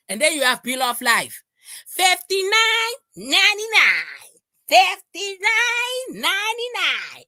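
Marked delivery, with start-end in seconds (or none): 1.9-7.2 slow, high drawn-out price; mock price call. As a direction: stretch out the price mockingly. Mocking the price.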